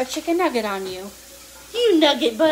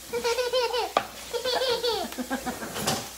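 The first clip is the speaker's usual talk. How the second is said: high delighted laugh; 11 semitones higher, 4 dB quieter than usual. Real, not acted.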